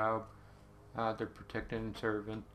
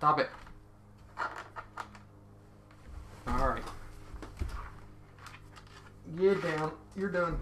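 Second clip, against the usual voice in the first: sharp scolding; 6 semitones higher than usual. Real, not acted. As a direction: break off to order someone to behave. Breaks off to scold someone nearby.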